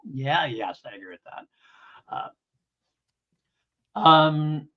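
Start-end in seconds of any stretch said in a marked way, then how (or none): none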